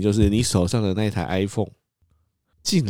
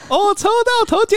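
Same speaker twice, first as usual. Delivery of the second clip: ecstatic; 5 dB louder, slower than usual. Retold or imitated. Quoting friend's winning message.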